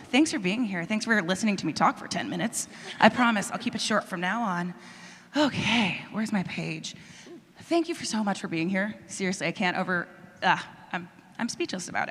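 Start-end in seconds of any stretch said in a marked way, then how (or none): none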